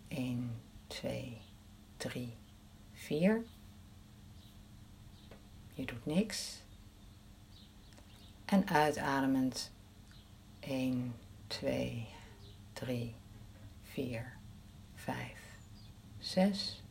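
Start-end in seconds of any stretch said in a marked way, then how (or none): 0.1-3.4 hushed, slow breath count; soft counting. Shown as a demonstration. 10.6-16.8 hushed, slow breath count; soft counting. Shown as a demonstration.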